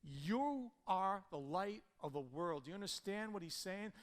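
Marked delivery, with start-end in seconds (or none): 0.0-2.6 solemn proclamation. Acted. Sermon opening proclamation.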